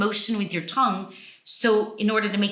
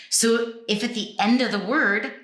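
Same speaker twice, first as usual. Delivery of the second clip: normal talking voice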